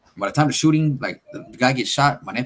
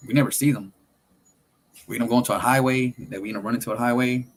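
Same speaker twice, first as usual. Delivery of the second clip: usual speaking voice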